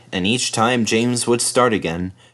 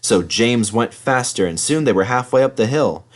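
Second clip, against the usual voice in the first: usual speaking voice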